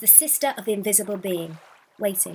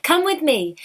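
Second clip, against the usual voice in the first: higher, louder, full of wonder; delighted; 7 semitones higher, 7 dB louder than usual. Acted. Sister welcoming the girl to her brother, delighted. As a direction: tell her joyfully, still amazed. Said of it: "cried the sister in wonder and delight".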